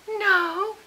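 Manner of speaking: high, sugary Southern mother; butter-wouldn't-melt Southern denial; sweet Southern voice. Retold or imitated. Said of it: "so sweet butter wouldn't melt in her mouth Southern voice".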